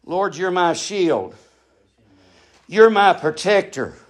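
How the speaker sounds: low, measured prayer voice, each phrase set apart. Acted. Quiet meditative scripture recitation.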